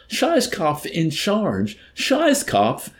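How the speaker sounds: a general losing all composure; hysterical. A narrator's reading. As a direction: come apart in panic over the name. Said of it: "went up in hysteria".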